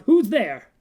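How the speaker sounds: sudden loud high cry in the dark; old man crying out from his bed, startled and loud. Acted. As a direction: jolt awake and call out in fright. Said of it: "crying out".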